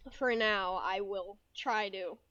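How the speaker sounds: ordinary talk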